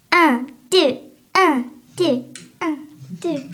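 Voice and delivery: chanted one-two count, loud and high; crier en rythme. Retold or imitated. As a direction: call out the count like a little coxswain. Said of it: "je suis sur la marmite à crier". (scander fort un, deux)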